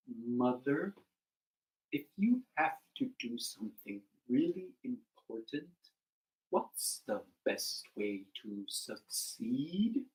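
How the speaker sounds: low, drawn-out turtle voice; slow turtle. Acted. Turtle asks his mother for advice, low and slow. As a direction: ask earnestly, one word at a time.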